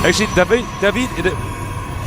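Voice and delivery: David with a hammed-up French lilt; fake French accent. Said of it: "the name David is not French".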